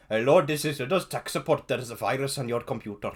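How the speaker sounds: mock Indian tech-support accent, half laughing; a hammed-up scam caller accent, lightly done. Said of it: "in an Indian accent".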